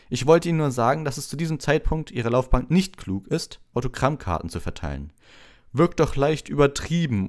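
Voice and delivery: pompous mentor giving advice; fatherly Lockhart. A narrator's reading. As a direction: lecture a pupil with self-satisfied indulgence. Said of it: "sagte Lockhart väterlich".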